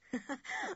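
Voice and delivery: short, high amused laugh; light laugh. A narrator's reading. Jock's mother hears Julian forget her name, amused laugh.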